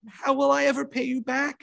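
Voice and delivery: high, strained groan of a sick woman. A narrator's reading. Sick widow fretting about repaying, groaning. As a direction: groan out the worry.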